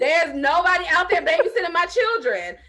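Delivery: real laughter; laughing. Real, not acted. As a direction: laugh it off, indignant. Laughing reply to host's tease.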